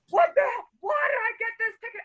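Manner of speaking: very high-pitched outraged yelling; screaming angry date. Retold or imitated. Date raging at getting a ticket, screaming. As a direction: imitate a furious man screeching in protest. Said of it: "He starts screaming at me".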